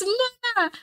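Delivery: put-on very high-pitched excited German child; German kid impression. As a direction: squeal it like a kid pleading with Mama.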